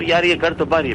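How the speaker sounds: imitated Pathan accent, high and loud; Pathan neighbour. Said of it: "वो एक Pathan family थी".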